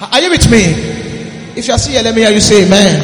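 loud rousing call to the congregation; calling for amen. Real, not acted. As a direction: rouse the church to shout amen.

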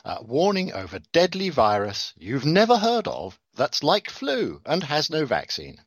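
ordinary delivery

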